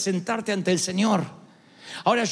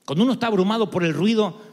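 ordinary talk, nothing marked

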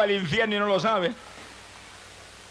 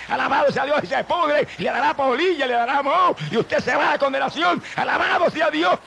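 high and loud fiery preaching; preaching at full force; 7 semitones higher, 4 dB louder than usual. Acted. Preacher warning the congregation about idols, high and loud. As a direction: lift the voice high and loud to warn them.

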